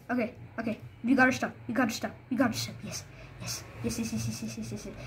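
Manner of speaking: soft, rapid, low muttering; greedy mutter. Real, not acted. Gamer grabbing his loot, softly muttering. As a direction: hoard it under your breath.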